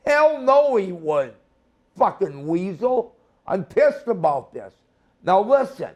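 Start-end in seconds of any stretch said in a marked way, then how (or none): 0.1-0.8 emphatic. Real, not acted. Emphatic repeat.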